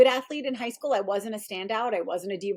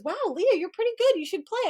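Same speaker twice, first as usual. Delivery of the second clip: much higher, playful roommate voice; impressed roommate; 10 semitones higher than usual. Retold or imitated. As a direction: mimic the roommate's impressed praise. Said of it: "She goes".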